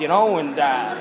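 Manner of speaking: usual speaking voice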